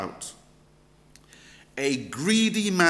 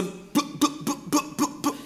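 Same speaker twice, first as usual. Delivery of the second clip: car breakdown beeps; 7 semitones higher than usual. Retold or imitated. Car breaking down mid-journey.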